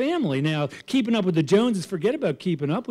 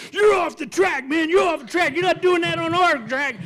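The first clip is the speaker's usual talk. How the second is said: angry track owner; 11 semitones higher than usual. Retold or imitated. Track owner yelling at driver, high angry shout. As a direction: yell at the rule-breaker. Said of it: "the guy who owns the place, goes".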